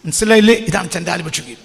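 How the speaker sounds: ordinary talk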